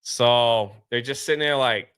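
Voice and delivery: usual speaking voice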